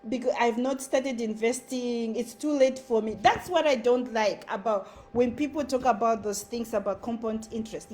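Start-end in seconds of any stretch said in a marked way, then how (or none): none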